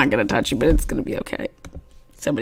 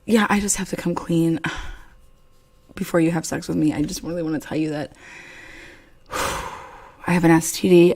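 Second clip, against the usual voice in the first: usual speaking voice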